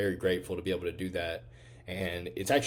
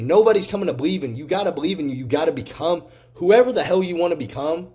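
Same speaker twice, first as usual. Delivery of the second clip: fired-up preaching; 7 semitones higher, 8 dB louder than usual. Real, not acted. Motivational speech at full force.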